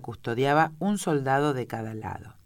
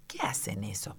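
hushed, whispered question; furtive whisper, much softer; very low voice; 4 semitones lower, 9 dB quieter than usual. A narrator's reading. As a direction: ask it under your breath. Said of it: "en voz muy baja".

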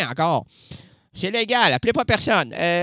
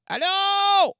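high calling; 13 semitones higher, slower than usual. Shown as a demonstration. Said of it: "j'entends moins".

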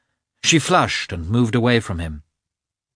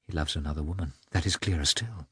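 much softer bitter murmur; said to herself; 3 semitones lower, 8 dB quieter, faster than usual. A narrator's reading. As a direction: say it low, only for herself. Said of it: "she said to herself".

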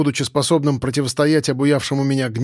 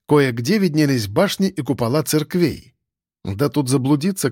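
ordinary delivery, nothing marked

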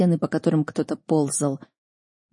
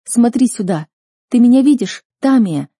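loud, higher, excited call; urgent calling; 5 semitones higher, 7 dB louder than usual. A narrator's reading. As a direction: call across to make her look. Waving, calling to captive girl. (громко и взволнованно зовёт)

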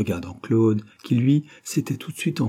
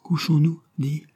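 higher-pitched gentle coaxing; murmured plea; 6 semitones higher than usual. A narrator's reading. Weary woman asks her working partner to bed, murmured plea. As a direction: coax him to bed in a small raised voice. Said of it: "murmura-t-elle".

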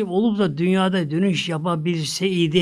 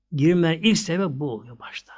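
ordinary delivery

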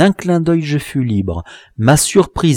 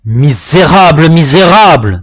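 higher, louder exclamation of outrage; outraged cry; 5 semitones higher, 7 dB louder than usual. A narrator's reading. Captive lunging at his tormentor, outraged cry. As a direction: cry out at a villain. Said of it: "m'écriai-je".

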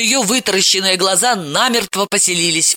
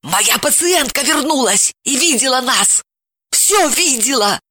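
hissed panic; 8 semitones higher than usual. A narrator's reading. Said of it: "прошипела она".

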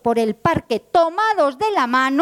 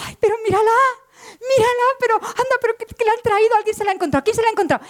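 much higher, near-tearful joy; overjoyed disbelief; 9 semitones higher than usual. Acted. Woman seeing her lost hope again, high and tearful. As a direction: gasp it out, close to tears.

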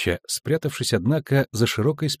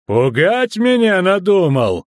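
loud, high, outraged roar; slow, indignant bellow; angry bark; 8 semitones higher, 6 dB louder, slower than usual. A narrator's reading. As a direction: refuse loudly to be intimidated. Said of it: "рявкнул он".

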